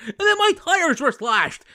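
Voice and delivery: high, wailing mock outburst; outraged teacher. Teacher lamenting the slashed tires, high mock outrage. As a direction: burst out high-pitched as the wronged car owner.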